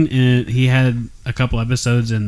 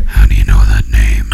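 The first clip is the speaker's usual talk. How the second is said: Batman growl; 5 dB louder than usual. Pretend. Batman Martha scene.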